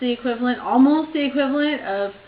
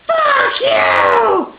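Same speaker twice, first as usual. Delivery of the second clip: loud, high outraged shriek; furious scream; 9 dB louder than usual. Retold or imitated. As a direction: imitate an insulted stranger screaming back. Retold insulted woman shouting back.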